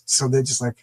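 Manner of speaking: ordinary talk, nothing marked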